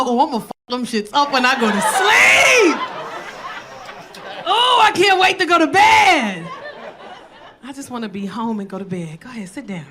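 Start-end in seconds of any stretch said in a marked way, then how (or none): 0.0-6.5 excited mock glee. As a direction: gush about bedtime, hammed-up. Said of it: "I was like".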